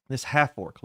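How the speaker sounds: usual speaking voice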